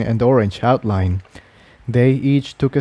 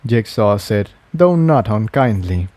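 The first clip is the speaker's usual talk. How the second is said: plain reading voice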